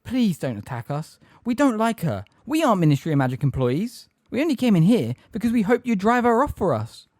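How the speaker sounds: high, urgent explanation; breathless plea. A narrator's reading. Hermione pleading with her captors, breathless and urgent. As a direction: plead your innocence in a rush of feeling. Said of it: "said Hermione breathlessly".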